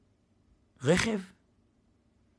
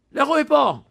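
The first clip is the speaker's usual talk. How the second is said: guard yelling; 8 semitones higher, 8 dB louder than usual. Retold or imitated. Guard chases the narrator away.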